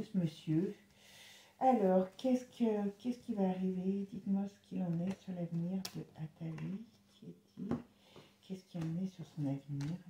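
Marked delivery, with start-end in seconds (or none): 4.1-10.0 quiet concentration. Real, not acted. Card reader softly questions the cards about his future, hushed. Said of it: "quand je parle doucement, c'est que je me concentre".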